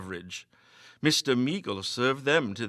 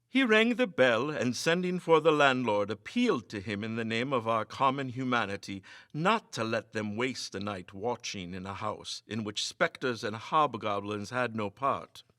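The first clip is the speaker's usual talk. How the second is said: ordinary delivery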